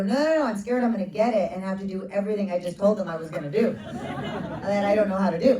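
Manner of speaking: high, loud and fast through laughter; laughing nervous reply. Retold or imitated. Answering Rowena's question, laughing nervously and fast. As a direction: laugh out the panic as you rush it.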